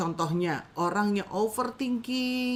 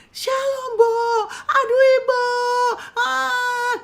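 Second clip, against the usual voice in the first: high, pleading whine with a cry; needy client whining; 14 semitones higher, 8 dB louder, slower than usual. Acted. Dependent client calling her counselor, high crying whine. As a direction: play a clingy counselee begging for help.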